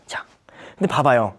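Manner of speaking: ordinary talk, nothing marked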